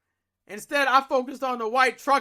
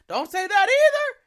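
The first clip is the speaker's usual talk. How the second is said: alarmed lady; 11 semitones higher, 6 dB louder than usual. Retold or imitated. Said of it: "And the lady's like".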